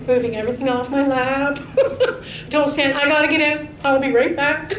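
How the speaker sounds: laughing through the words; chuckling as she tells it. Real, not acted. Telling congregation about rushing out, laughing.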